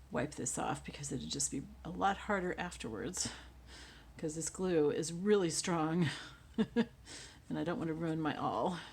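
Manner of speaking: ordinary talk, nothing marked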